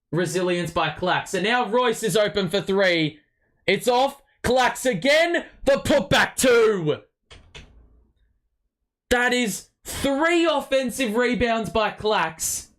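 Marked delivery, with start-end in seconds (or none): 1.3-12.7 excited shouting. Real, not acted. Excited fan calling offensive rebounds.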